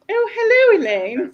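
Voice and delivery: high, loud mock greeting; David. Imagining him coming in to greet Elaine, hammed-up impression. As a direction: imitate him arriving, pitch leaping up then dropping. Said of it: "can imagine if he come in and went".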